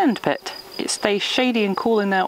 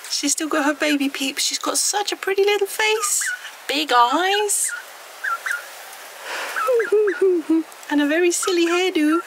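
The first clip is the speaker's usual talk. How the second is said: very high cooing voice; 12 semitones higher than usual. Real, not acted. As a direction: coo at the little chick. Talking to the chick.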